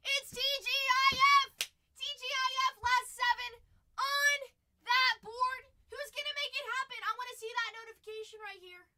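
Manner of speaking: loud, high rallying shout; hype announcement. Real, not acted. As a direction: fire up the crowd for the weekend. Hypes Friday and last spots.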